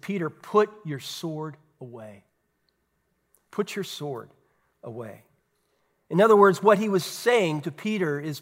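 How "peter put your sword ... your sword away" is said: low, soft retold command; hushed, restrained order with pauses; quiet rebuke. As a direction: rebuke a follower softly, sinking lower and quieter. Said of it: "Jesus rebuked him".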